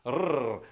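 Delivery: a long trilled "Rrr"; rolled R. Shown as a demonstration. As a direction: roll the "Rrr" on the tongue. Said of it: "lots of rolled R's".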